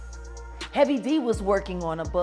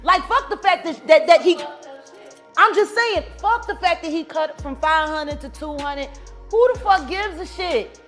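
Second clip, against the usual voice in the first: loud, very high-pitched rant; outraged disbelief; 13 semitones higher, 11 dB louder than usual. Real, not acted. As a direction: rant at full pitch, real indignation. Heated rant over money.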